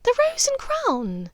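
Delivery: high, bright laughing cry of the pub name; laughing exclaim. A narrator's reading. Narcissa laughing with her sister over the old pub. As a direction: laugh it out, delighted by the memory. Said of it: "Narcissa laughed".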